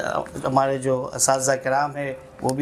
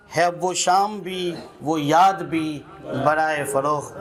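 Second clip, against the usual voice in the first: measured, lilting recital with repeated lines; poem recitation; 4 semitones higher than usual. Acted. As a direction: savour each line like a poet at a mushaira. Recites a nazm at a gathering. (नज़्म पढ़ने का अंदाज़)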